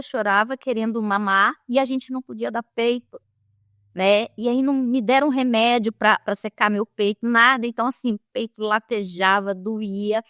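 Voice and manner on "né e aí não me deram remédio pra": ordinary talk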